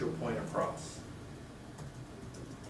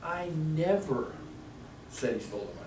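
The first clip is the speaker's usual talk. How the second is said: "I never" higher and slowed; stress on never; 4 semitones higher, 4 dB quieter than usual. Shown as a demonstration. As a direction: drag "never" out and hold it. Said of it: "seven different meanings".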